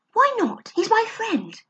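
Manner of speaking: put-on high, protesting boy; indignant boy. Barney defending his friend to Lou, indignant boy. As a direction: protest like a stubborn child.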